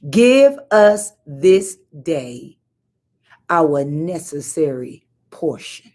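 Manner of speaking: very slow, each word spaced out; savouring each word slowly; slow emphatic reading. Shown as a demonstration. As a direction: draw out every word of the verse. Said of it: "It changes everything".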